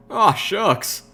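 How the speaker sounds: high, grinning folksy voice; Calamity. A narrator's reading. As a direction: say it through a grin, pitched high. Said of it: "Calamity said, still grinning".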